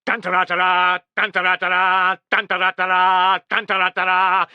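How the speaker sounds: sung-out trumpet fanfare; imitated trumpet call. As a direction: blare the advance. Trumpets again.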